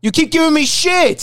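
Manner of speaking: a high, slow, exasperated cry, voice cracking up; complain, fed up with the teasing; exasperated high outburst. Real, not acted. Angry outburst at co-host.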